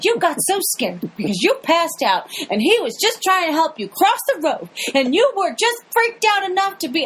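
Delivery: high, incredulous accusing voice; mock outraged George. George grilling the cyclist on camera, high mock outrage. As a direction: rip into the cyclist's story, mock indignant. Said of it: "He's like".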